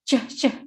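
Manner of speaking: ceh as a short "cher" syllable, repeated; isolated "ch" sound. Shown as a demonstration. Teacher drilling the class on a sound, separate "ceh" syllables. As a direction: sound out ceh twice, separately, like the end of temperature. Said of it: "ceh sounds".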